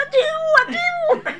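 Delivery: very high, loud shouted cry; high shout of the struggling man; retold yell. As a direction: yell it desperately through laughter. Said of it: "והוא צועק".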